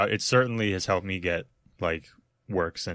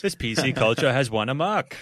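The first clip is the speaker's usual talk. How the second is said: Matt Maron; 4 semitones higher, 3 dB louder than usual. Retold or imitated. Comic mocking Matt's complaints, slightly raised impression. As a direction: mimic a speech impediment, laughing. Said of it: "in Matt Maron voice".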